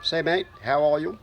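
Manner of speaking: high, hammed-up Aussie voice; mock Australian accent. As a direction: play a cheery Australian bloke. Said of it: "I am Aussie".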